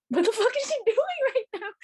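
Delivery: high-pitched baffled outburst; re-enacting a squealed, incredulous question; alarmed disbelief. Fan watching Kim begin her answer, high incredulous outburst.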